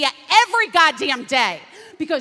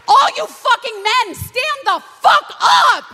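high, loud, slow angry yell; furious shout; 9 semitones higher, 6 dB louder than usual. Real, not acted. Ranting at men to stand up for abortion rights, furious. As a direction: call them out, loud and slow.

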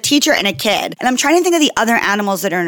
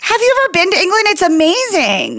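excited gushing; 9 semitones higher than usual. Real, not acted. Urging co-host to love England, excited gushing. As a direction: gush about a place you adore.

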